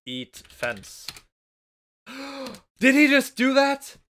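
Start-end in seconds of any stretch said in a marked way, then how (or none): 2.1-2.6 shocked gasp. Real, not acted. Shocked at game action. 2.8-4.0 much higher and louder shriek of disbelief; shocked disbelief. Real, not acted. Shocked at game action.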